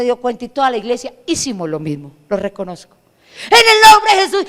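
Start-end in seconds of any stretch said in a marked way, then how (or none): 3.5-4.5 shouting the name of Jesus at full force; loud rebuke. Retold or imitated. The whole church rebuking demons, a huge shout. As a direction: bellow it like a church casting out demons.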